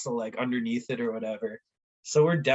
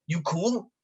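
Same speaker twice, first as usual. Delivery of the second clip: higher-pitched attempt at an Irish accent; rough mock Irish accent; thick Irish accent; 6 semitones higher, slower than usual. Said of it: "some thick Irish accent, he's like".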